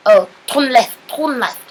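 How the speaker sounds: high mock heavy accent, giving directions; Bob's heavy accent. Student mimics driving instructor at a roundabout, heavy accent. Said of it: "heavy, heavy accent".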